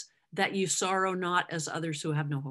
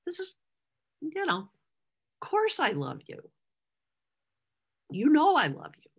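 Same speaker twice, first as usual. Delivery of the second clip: much higher, incredulous and exasperated; imitating an exasperated Peter, pitched high; 9 semitones higher, faster than usual. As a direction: play it as a hurt, disbelieving friend. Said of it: "I can imagine he was kinda like".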